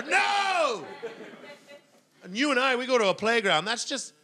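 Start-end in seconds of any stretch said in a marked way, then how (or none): 0.0-0.8 shocked cry. Acted. Exclaiming about toddler violence.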